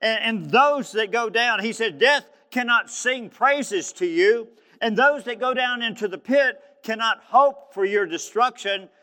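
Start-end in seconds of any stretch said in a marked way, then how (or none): 0.0-1.6 loud, high, slow declamation; crying out. Retold or imitated. Preacher voicing a man's plea to God, crying out. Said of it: "he's crying out to God". 2.0-8.9 loud, high, slow declamation; crying out. Retold or imitated. Preacher voicing a man's plea to God, crying out. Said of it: "he's crying out to God".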